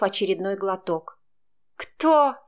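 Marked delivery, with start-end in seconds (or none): 1.8-2.4 high, teasing mock question; snide. A narrator's reading. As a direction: needle her with a sly high кто. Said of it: "ехидно уточнила Илона". (ехидно)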